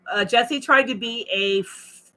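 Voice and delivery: ordinary delivery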